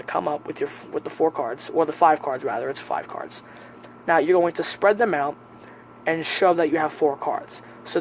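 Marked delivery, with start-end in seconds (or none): none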